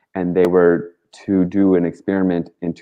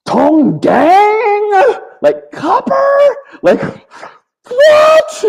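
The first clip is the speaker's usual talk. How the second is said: squeaky high mocking voice, much higher and louder; mock confused local; 8 dB louder, much slower than usual. Retelling sellers gawking at her, high squeaky mock voice. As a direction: squeal it like a baffled market seller.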